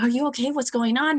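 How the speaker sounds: lightly panicked check-in; retold panic. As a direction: imitate an anxious mom on the phone. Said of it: "Calls me in absolute panic".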